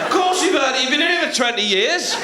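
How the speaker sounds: high-pitched indignant comeback; hammed-up irate retort; exasperated retort. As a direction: argue back at the heckler, pitch up. Acted row with heckler.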